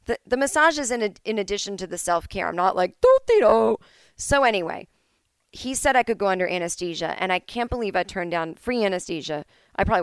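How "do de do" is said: carefree hum. Retold or imitated. Mocking carefree self at massage.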